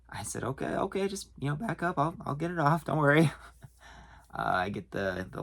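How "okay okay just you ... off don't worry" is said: high-pitched, appeasing retelling; nervous placating. Retold or imitated. Clerk trying to back off an angry customer, placating. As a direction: act out hurriedly calming someone, voice pitched up.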